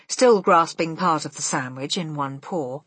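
usual speaking voice

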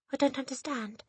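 put-on high child's voice; reply in a high, boyish voice; Eric. A narrator's reading. Boy standing up to the headmistress, high voice.

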